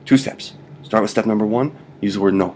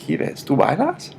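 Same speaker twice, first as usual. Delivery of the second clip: playful question; 7 semitones higher, slower than usual. Shown as a demonstration. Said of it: "You ask me the question".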